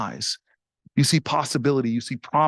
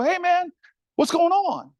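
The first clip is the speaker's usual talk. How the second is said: much higher, breezy "hey man" call; too casual greeting; 12 semitones higher than usual. Retold or imitated. Senator calling from his car window to a stranger, breezy and high. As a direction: toss it off like a chummy buddy. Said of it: "the tone of my voice was not right".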